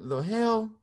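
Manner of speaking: high-pitched, puzzled exclamation; retold startled reaction, pitch jumping up; baffled disbelief. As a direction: ask it in stunned confusion. Acted bafflement at incoming call.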